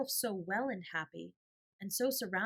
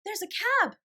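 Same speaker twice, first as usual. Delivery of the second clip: much higher, louder excited cry; excited exclaim; 10 dB louder than usual. A narrator's reading. Janet spotting her papa's cab, much higher and louder. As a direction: burst out seeing the cab. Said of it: "exclaimed Janet".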